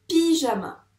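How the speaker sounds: "pyjama" slow with a long, loud first pi; careful teacher's "pyjama"; word sounded out. Shown as a demonstration. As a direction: stretch the pi, then finish "pyjama". Teacher confirms the word slowly.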